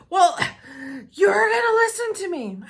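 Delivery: father refusing to be cut off, pleading; whiny dad. Retold or imitated. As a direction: plead loudly like the distressed father. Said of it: "father insisting".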